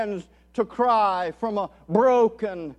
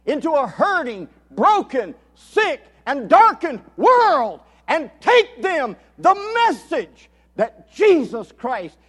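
impassioned preaching; 4 semitones higher, 8 dB louder than usual. Acted. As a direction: build from measured fervour to loud, high proclamation. Preaches at full force.

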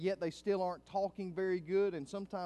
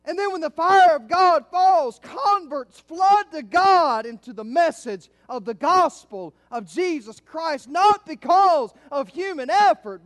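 high, loud, drawn-out preaching cry; full-force preaching; 12 semitones higher, 19 dB louder, slower than usual. Real, not acted. Preacher to the church on God's fire, full-force proclaiming.

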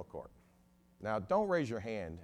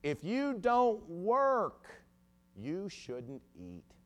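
pulpit preacher; 10 semitones higher, 7 dB louder, much slower than usual. Retold or imitated. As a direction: thunder it slowly at the congregation, then drop for the verdict. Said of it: "he stood up there and said".

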